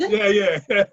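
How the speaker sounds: laughing through a loud yeah. Real, not acted. Answering the shelter pun with a real laugh.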